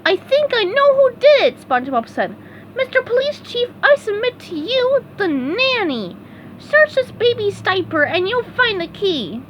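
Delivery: very high, eager cartoon sponge; bright squeaky helper ready to go; eager SpongeBob voice. A narrator's reading. SpongeBob volunteering to his boss, high eager voice.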